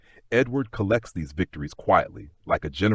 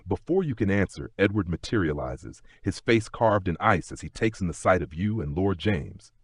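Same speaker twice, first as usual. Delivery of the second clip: usual speaking voice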